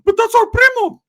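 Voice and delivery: very high, loud cartoon squeak. [name] squealing at his cousin in a Mickey Mouse voice. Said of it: "from Mickey Mouse to [name]".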